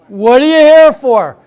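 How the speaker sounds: loud, drawn-out comic exaggeration; exaggerated imitation of a clueless questioner; mock repeated question. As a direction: ham up the question, slow and loud. Yet another staffer repeats the question.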